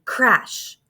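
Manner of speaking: ordinary delivery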